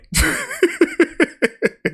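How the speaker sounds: high giggle. Real, not acted. Laughing at viewer's joke.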